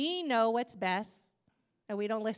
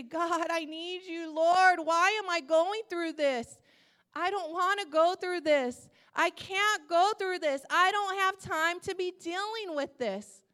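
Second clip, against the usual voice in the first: higher, louder pleading with God; crying out; 4 semitones higher, 6 dB louder than usual. Retold or imitated. Believer cries out to God amid trial, loud anguished plea. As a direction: cry out in building protest and desperation. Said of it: "when we cry out to God".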